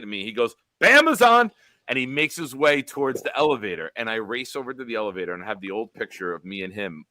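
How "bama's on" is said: high, loud call back without looking; loud brush-off. Retold or imitated. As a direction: toss it back loudly over the shoulder. Joe answers while walking past.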